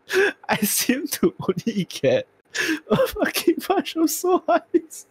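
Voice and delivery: high, cracking mock cry; hammed-up whiny wail. Mock wail over unwanted viewers.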